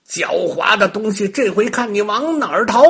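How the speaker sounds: a touch of a menacing witch; angry witch. A narrator's reading. Witch corners the rabbit again, mildly angry. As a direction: threaten the sly rabbit.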